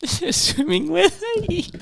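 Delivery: high, slow laughing repeat; laughing echo. Real, not acted. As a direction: echo it while cracking up. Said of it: "friend echoes with laughter".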